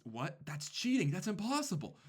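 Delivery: put-on high-pitched outraged kid's cry of disbelief. Student crying foul at the trap card, high outraged squeal. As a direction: squeak it out like a sore loser. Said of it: "and Blondie goes".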